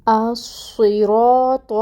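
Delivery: الصراط with a full, heavy ط and a rounded vowel; heavy ṭa in recitation. Shown as a demonstration. Teacher demonstrating the heavy letter ط in الصراط. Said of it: "اس کو ہم نے تا نہیں پڑھا".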